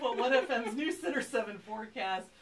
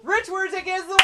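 loud, high winner call; excited announcement; 8 semitones higher, 6 dB louder than usual. Real, not acted. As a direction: shout out the winner.

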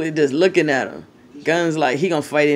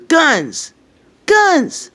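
loud call, higher on the second; 12 semitones higher, 9 dB louder than usual. Real, not acted. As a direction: shout the dog's name across the yard. Calling the dog.

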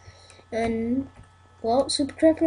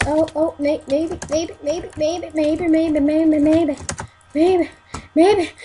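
excited, building louder; 4 semitones higher, 5 dB louder, slower than usual. Real, not acted. Hoping for a kill while fighting.

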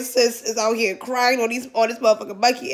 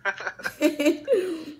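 high, soft run of laughter; real high laugh; high giggle; 5 semitones higher, 6 dB quieter than usual. Real, not acted. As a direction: giggle helplessly at your own joke. Laughs after saying she's bald-headed.

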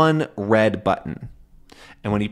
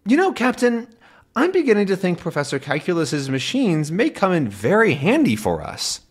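put-on much higher, lighter young voice; Tintin's voice; 8 semitones higher than usual. Tintin telling Captain about the machines, youthful voice. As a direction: sound bright and knowing. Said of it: "And then Tintin says".

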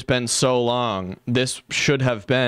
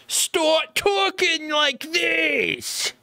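very high, drawn-out mimicking cry; mocking imitation; much slower than usual. Streamer echoing the video's replayed line, high mocking imitation. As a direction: parody the voice with stretched high words.

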